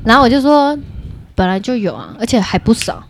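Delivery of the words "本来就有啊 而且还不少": softer, eerie matter-of-fact; quietly spook the listeners; a low ghost-story hush; hushed spooky reveal. Retold or imitated. Spooky reveal to scared friends.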